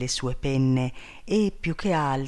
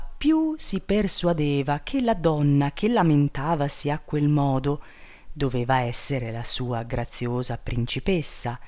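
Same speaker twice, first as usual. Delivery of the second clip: plain reading voice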